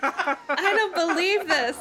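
real laughter breaking into the line; laughing while reading. Real, not acted. Reader cracks up mid-story, laughing through the line. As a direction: try to read on through high, loud giggles.

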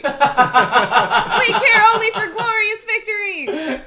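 high, slow, loud laughing proclamation; grand alien boast. A narrator's reading. Helmicron females boasting of victory, slow grand high voice. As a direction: declaim it like a tiny war hero.

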